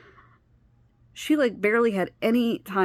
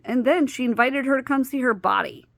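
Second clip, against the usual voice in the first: ordinary delivery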